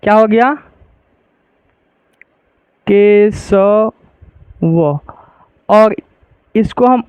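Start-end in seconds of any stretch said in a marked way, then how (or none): 2.9-3.9 केशव drawn out very slowly while writing; slow writing demo. Shown as a demonstration. Teacher writing the word on the board, very slow. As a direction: spell केशव slowly, syllable by syllable. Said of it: "लिख के आप लोगों को दिखा देते हैं".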